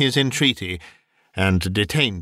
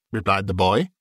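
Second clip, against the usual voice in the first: plain reading voice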